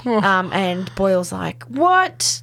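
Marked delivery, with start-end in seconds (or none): 1.6-2.4 high, acted-out "what" of disbelief; stunned reaction. Co-host acting out a colleague's reaction to a stakeout, stunned. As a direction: voice someone taken aback, pitched right up.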